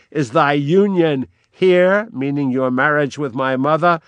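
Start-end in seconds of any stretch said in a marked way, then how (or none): 0.1-2.1 louder, higher and slow, bitingly ironic; powerful irony. Acted. Hamlet mocking the king's marriage, powerful and ironic. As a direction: lean hard on the irony. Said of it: "very powerfully and ironically".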